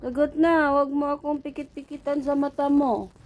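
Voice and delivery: high-pitched, insistent telling-off; exasperated mock scolding. Owner scolds cat for blinking instead of answering, exasperated mock scolding.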